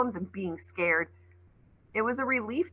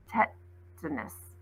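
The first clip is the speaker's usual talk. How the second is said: dry echo; 3 semitones lower, 10 dB quieter, much slower than usual. Acted. Ollie's dry sarcastic echo.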